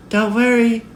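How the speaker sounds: put-on squeaky-high carefree child; little girl. A narrator's reading. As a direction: call back reassurance in a high child's voice. Little girl reassures her mother.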